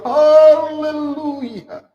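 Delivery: slow, drawn-out reverent Haleluya; tender, unhurried Haleluya; gentle hallelujah. Shown as a demonstration. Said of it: "Itu kepenuhan Roh Kudus".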